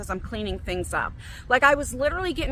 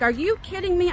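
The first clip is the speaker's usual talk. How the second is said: incredulous question, pitched up; retold disbelief; 6 semitones higher than usual. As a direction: react in mild outrage. Retold reaction to finding droppings.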